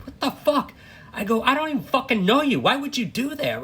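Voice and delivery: high, loud retold outrage at a stranger; outraged yell. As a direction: yell it, fed up and disgusted. Yelling at the laughing stranger.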